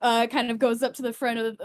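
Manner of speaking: ordinary talk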